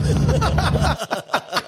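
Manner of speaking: high cackling laugh at own punchline; big laugh. Real, not acted. Teller laughs at own joke.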